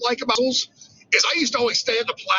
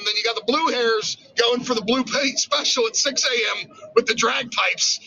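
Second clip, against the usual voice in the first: ordinary talk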